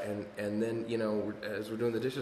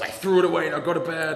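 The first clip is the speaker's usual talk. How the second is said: raised, louder husband retort; mock snap; 6 semitones higher, 9 dB louder than usual. Husband at two a.m. telling speaker to bed, mock snap. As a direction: snaps back to send her to bed.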